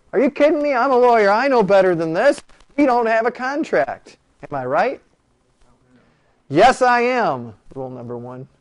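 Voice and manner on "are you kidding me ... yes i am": high, loud, indignant protest; mock outrage. Professor acting out his outrage at the hospital bill, higher and louder. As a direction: play up the outrage, refusing to pay. Indignant lawyer refusing to pay.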